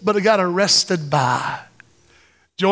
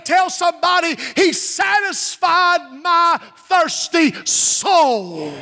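high-pitched, driving sermon delivery; impassioned preaching; 5 semitones higher than usual. Real, not acted. Preaches at full force.

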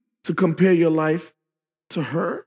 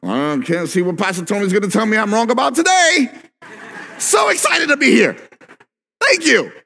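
sarcastic excitement; 6 semitones higher than usual. Pretend. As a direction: gush with mock excitement, much higher. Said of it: "we don't walk in here going".

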